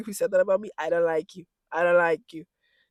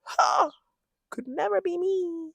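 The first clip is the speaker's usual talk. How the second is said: high, slow, dismissive singsong; singsong scoff; 11 semitones higher, slower than usual. Pretend. Vlogger dismissing being stuck with a child, singsong scoff. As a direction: draw it out up high, playfully disowning it.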